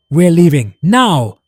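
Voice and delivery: roared order. A narrator's reading. Mark orders everyone out as the ooze surges, roaring. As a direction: bellow the order to get out. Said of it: "Mark roared".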